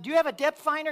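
high, loud call down to the boat; calling down to a fisherman far below; yelling down. Retold or imitated. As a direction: shout it down from the cliff. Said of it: "I yelled down".

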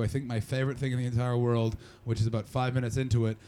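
ordinary talk, nothing marked

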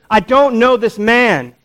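high, loud, drawn-out angry protest; angry, annoyed denial. Acted. Peter cursing at his accusers, angry and annoyed. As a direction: deny it in exasperated anger, stretching each word. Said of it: "He's angry. He's annoyed".

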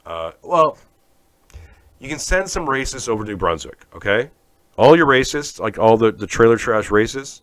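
ordinary delivery

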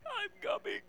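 a hammed-up spooky I'm coming; spooky ghost wail. Mock spooky Jesus sneaking up.

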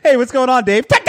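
high, loud hammed-up greeting into a yell; mock Thai shouting. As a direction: shout it as a joking impression. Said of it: "not the Thai accent".

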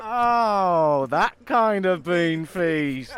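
slow, stretched-out comic "oh, I see"; mock dawning realisation. Giles learns what a bean feast means, mock realisation. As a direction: draw it out in a put-on voice of understanding. Acted sudden understanding in skit.

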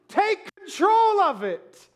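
high, drawn-out, hammed-up cry; urgent plea. Novice tells pilot something's wrong, pleading loudly.

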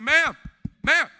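loud, high "Ma'am" to grab her attention; urgent call out. Retold or imitated. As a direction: call out to the desk clerk, desperate. Pleading for attention at desk.

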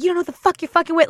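high-pitched mock threat; retold scream. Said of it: "So I just screamed at him".

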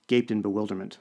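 plain reading voice

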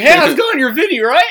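put-on high, loud drunken greeting; drunk friend. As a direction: holler like a drunk greeting the whole bar. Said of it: "he was very drunk and he goes".

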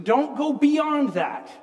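usual speaking voice

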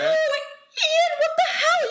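high, distressed wail; pained outcry. Acted. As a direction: cry out in pain and outrage. Bitten, confronts dragon owner.

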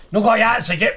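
loud mock quote. Mimicking the old man leaving.

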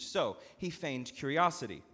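ordinary delivery

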